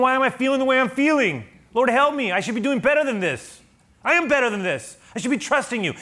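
loud, high-pitched cry to God; anguished self-reproach. Acted. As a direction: berate yourself in frustrated prayer. Acted self-reproach of the psalmist.

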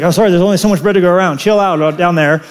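fast, offhand apology; mock God reply. Preacher voicing God with no supply, fast offhand. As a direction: rattle it off casually. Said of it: "He's like".

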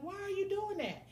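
high, lightly voiced friend asking why; a hint of a puzzled friend, high; imitating her friend. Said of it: "she was like".

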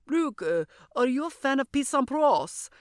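imitated French accent, lifting in pitch; Julien. French exchange student asks about tennis, mock French accent. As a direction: put on a muddled French accent. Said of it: "what kind of accent that was".

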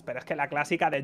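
normal talking voice

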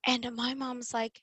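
ordinary delivery

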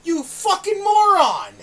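yelling at Jericho through the screen, outraged; furious shout. Retold or imitated. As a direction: scream at the TV like he ruined the show. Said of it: "and I'm like".

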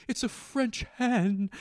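high, drawn-out mock voice; imitated shop staff. Supermarket staff presenting a French hen, mock high voice. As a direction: pitch it way up, stretching the words. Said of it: "And they'd be like".

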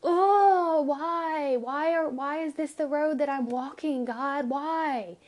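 high, pleading cry to God; anguished complaint. Acted. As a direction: wail it out like a hammed-up lament. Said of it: "but in a".

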